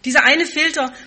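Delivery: preacher announcing a new point; preaching emphasis. Real, not acted. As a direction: proclaim the first filter.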